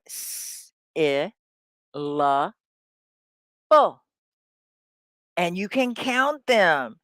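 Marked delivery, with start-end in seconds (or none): 0.0-4.0 "S, I, la, bu" as isolated sounds with long pauses; one sound at a time, starting on a hissed "S". Shown as a demonstration. Teacher shows a syllable is a sound, sounded out slowly. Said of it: "So it's a sound, right?".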